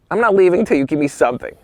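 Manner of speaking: high, quick mock insistence; insistent begging. Young photographer refusing to leave a magazine empty-handed, begging insistently. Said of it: "I begged Hollywood Life".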